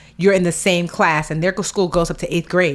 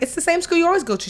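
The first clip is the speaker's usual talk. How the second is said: much higher, fast, disbelieving; incredulous mom; 11 semitones higher, faster than usual. Retold or imitated. Mom surprised her brave daughter is scared, quick and high.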